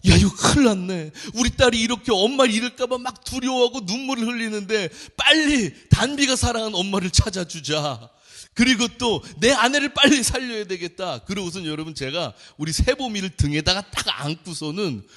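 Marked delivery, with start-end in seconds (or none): 0.1-11.2 urgent resolve. Retold or imitated. Father's urgent resolve in dream.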